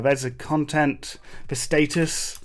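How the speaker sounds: normal talking voice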